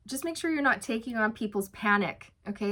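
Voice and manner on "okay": ordinary talk, nothing marked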